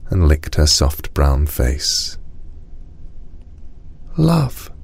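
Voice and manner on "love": put-on high, small child's voice; a hushed first word, pitched high; whispering girl. A narrator's reading. Cinnamon answers the tiger after long silence, high hushed voice. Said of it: "whispered Cinnamon, in a voice wild and dark from disuse".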